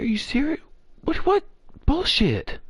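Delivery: high, loud incredulous rant; mock outrage. Imitating the beaten opponent, high loud mock outrage. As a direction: squeal the protest, hammed-up. Opponent's disbelief after touchdown.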